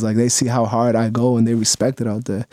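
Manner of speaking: normal talking voice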